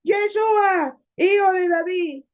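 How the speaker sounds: high, drawn-out plea shouted from the roadside; acted desperate shout; crying out. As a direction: call out high, begging for mercy. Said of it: "él comenzó a gritar y a decir".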